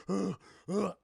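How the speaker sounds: two short heaving grunts; punchy wordless huffs; imitated menacing grunts. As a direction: mimic a masked slasher's effortful grunt. Imitates masked man wielding saw.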